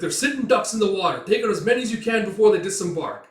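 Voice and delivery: officer yelling on the wall; battle order. Acted. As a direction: urge them to pick off targets. Ordering fire on boats.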